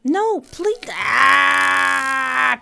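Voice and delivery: high pleading cry breaking into a long scream; plead, then let out a drawn-out yell; hammed-up death scream. Acting out being killed in game.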